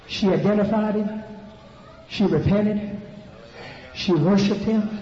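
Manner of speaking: loud, high, ringing sermon shout; climbing Pentecostal shout over the music; full-force preaching. Acted. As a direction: preach it to the rafters.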